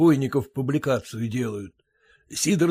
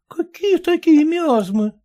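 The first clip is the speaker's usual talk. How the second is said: high, slow puzzled wife; baffled wife; 13 semitones higher, 3 dB louder than usual. Acted. Wife puzzled by husband's miasmas, high slow voice.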